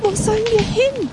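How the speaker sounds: very high, distressed squirrel voice; calling squirrels. A narrator's reading. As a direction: cry it high like lost little squirrels. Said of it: "riefen die Eichhörnchen". (hohe, ratlose Eichhörnchenstimme)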